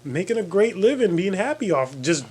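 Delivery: high-pitched, exasperated encouragement; urging on. Retold or imitated. Friend urging a shy would-be model, high and insistent. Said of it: "And I'm like".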